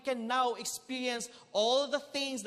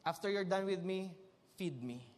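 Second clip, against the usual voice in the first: bored cat; 4 semitones lower, 3 dB quieter than usual. Acted. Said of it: "It would approach you".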